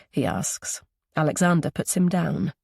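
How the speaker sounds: ordinary delivery, nothing marked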